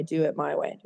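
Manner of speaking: soft, low ironic mutter under the breath; muttered aside. Shown as a demonstration. Sponsor mocking her own bossy attitude, muttered quietly. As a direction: toss it off quietly, half to yourself.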